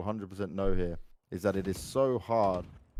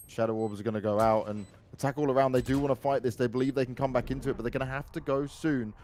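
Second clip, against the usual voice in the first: excited play-by-play, higher and louder; esports caster; 7 semitones higher, 6 dB louder than usual. Acted. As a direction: build to the clutch call. Live round play-by-play.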